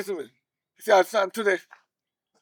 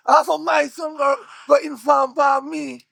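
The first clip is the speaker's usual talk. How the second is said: raised, loud, emphatic scolding; angry rebuke; 5 semitones higher, 4 dB louder than usual. Acted. Boss berating his man, angry. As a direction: drive each word home angrily.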